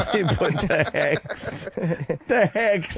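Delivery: laughing echo. Real, not acted.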